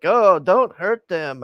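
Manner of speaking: high, loud, drawn-out whiny plea; mock pleading. Commentator mocking the preacher's plea for immigrants, exaggerated pleading. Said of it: "and she's like".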